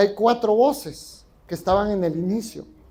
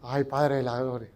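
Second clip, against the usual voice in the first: mock dismay; 7 semitones lower, 7 dB quieter than usual. Mock exasperated exclamation.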